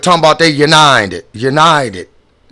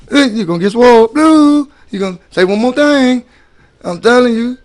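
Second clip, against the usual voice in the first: louder, higher, hammed-up threatening; mock bully threat; 6 semitones higher, 4 dB louder than usual. Imitating a bully threatening Blue, louder and higher. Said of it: "that's a bully".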